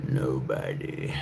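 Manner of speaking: dropped low and slow; low slow echo; deep slow. Acted. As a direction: sink the word deep and drag it out. Echoing own line in lower voice.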